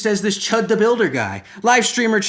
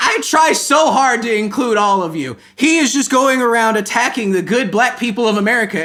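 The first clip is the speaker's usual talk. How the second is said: high, loud indignant rant; worked-up, hammed-up indignation; exasperated pleading; 6 semitones higher, 5 dB louder than usual. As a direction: protest it in comic outrage. Indignant rant continues after scream.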